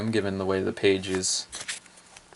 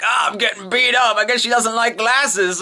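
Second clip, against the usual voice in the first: higher, rueful put-on voice; Musashi's voice; 11 semitones higher than usual. Musashi taking a beating, higher rueful voice. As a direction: groan it wryly. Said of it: "Musashi's like".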